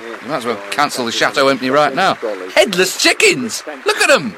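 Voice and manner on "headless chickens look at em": higher, slow mocking exclamation; a touch of jeering scorn at the telly; scornful. Real, not acted. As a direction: jeer at them. Mocking people on screen.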